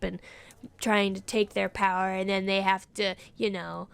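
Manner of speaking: a low, sly witch boasting of hexes; low witchy voice. Acted. Witch telling a stranger her trade, low and sly. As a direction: play the witch low and knowing, sinking to a hush.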